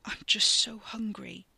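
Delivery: slow, tense whisper with no voice. A narrator's reading. Ricky admits his hunger to the man, tense whisper. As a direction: breathe it out, ashamed and strained. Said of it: "his voice came out in a tense whisper".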